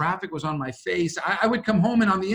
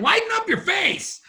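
voicing his daughter. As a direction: boss him like a bossy four-year-old. Paraphrasing daughter's advice.

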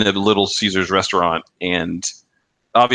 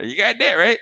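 higher, punchy imitated old man; old man's catchphrase; 11 semitones higher than usual. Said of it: "that particular line became, like, an in-joke".

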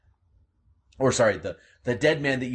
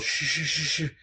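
soothing; 7 semitones higher than usual. Acted. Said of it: "And Gerald".

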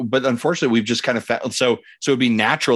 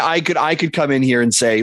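ordinary talk, nothing marked

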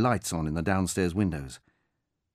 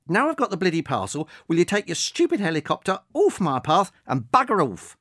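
put-on higher and louder, an exasperated elderly tell-off; irate old woman; 8 semitones higher, 5 dB louder than usual. Old woman ordering the drone off her path, irate. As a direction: send him packing. Margaret telling him off.

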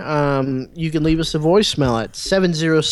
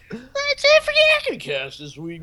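Jeff Bridges voice. Shown as a demonstration. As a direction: say it like a lazy dude. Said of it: "should I say the rest like Jeff Bridges?".

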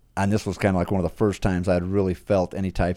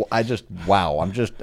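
ordinary talk, nothing marked

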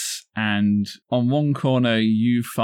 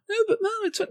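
put-on whiny kid voice; 4 dB quieter, much faster than usual.

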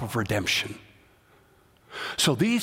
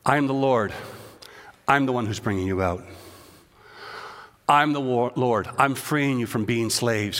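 tender voice of God; 4 semitones lower than usual. Acted. God reassuring his enslaved people, lower and tender. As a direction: speak it as a tender promise.

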